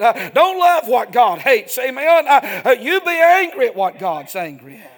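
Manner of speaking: loud, high revival-style preaching; full-force preaching. Real, not acted. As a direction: thunder it at the congregation, punching the key words.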